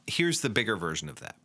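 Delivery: normal talking voice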